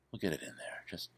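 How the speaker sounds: soft breathy whisper of a gentle painter; whispered Bob Ross. Retold or imitated. To viewers, narrating his coffee in a whisper. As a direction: whisper it reassuringly. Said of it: "narrating my hands".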